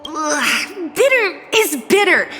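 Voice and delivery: high, whiny disgust at bitter taste; bitter medicine. Acted. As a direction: wince through a bitter sip. Complaining about bitter tea.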